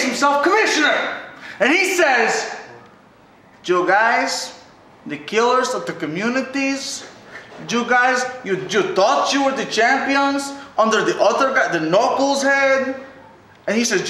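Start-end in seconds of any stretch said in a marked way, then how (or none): 3.6-4.6 a lightly put-on German accent, lower; mock Schmidt's German accent; lightly imitated German accent. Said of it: "It's tough to do his German accent". 5.1-7.1 the killers of the communities in a put-on German accent, lower; mock German accent on the killers of the communities. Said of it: "It's tough to do his German accent". 7.7-13.0 mocking Schmidt in a German accent; imitating a German accent. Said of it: "It's tough to do his German accent".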